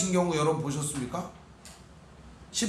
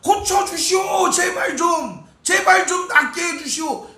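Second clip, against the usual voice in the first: loud, high, slow begging cry; desperate shouted plea; 10 semitones higher, 6 dB louder, slower than usual. Retold or imitated. Mother shouting to Jesus to heal her daughter, desperate loud cry. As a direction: scream for mercy to heal. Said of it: "소리라도 질러야죠".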